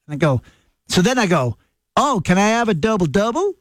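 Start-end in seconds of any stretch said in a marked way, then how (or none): 2.0-3.5 put-on northern English accent flattened toward Canadian, rising at the end; softened Canadian-style accent. Trying again at the drive-through so staff understand, in a toned-down northern English accent. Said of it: "sometimes I do it like that".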